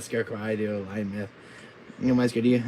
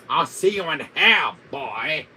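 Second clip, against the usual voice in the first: loud, raised villain threat; hammed-up villain farewell; Wesker; 5 semitones higher, 7 dB louder, slower than usual. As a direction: send them off with villainous menace. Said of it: "my Wesker voice".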